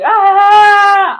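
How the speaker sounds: loud, high-pitched mock wail; exaggerated crying "aah"; imitated child crying. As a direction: bawl like a kid with one long shriek. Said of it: "started crying".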